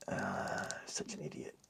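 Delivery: whispered self-scolding; whispered self-reproach. Real, not acted. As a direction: hiss it to yourself.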